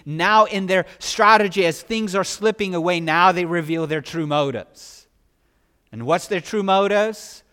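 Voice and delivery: preacher pressing the story home; preaching at full force. Acted.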